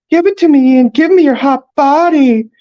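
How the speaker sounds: very high, loud swooning squeal; mock lovestruck falsetto. A narrator's reading. Best friend teasing Ian about his crush, mock lovestruck falsetto. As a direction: tease in a screechy lover's falsetto. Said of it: "his voice rising several octaves".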